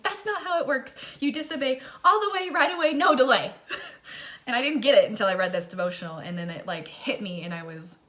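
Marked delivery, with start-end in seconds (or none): 0.0-3.5 high, loud mock-mom scolding; mom snapping. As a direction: scold like an exasperated mom, climbing to a near laugh. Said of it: "And she's like".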